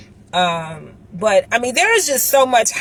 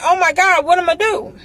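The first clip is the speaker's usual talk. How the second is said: high, louder mock-panicked cry; retold dismay; 9 semitones higher, 4 dB louder, faster than usual. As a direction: recall being at a total loss, voice pitched up. Retelling shock of covid shutdown.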